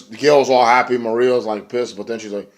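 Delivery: usual speaking voice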